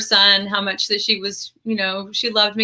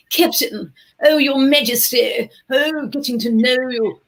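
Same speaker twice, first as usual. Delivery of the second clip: clipped vowels of 1940s royal English; old-fashioned regal British accent; 1940s British Queen accent. Shown as a demonstration. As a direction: do the Queen's English, circa nineteen forty. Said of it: "my best in, in interpretation of the Queen in circa nineteen forty".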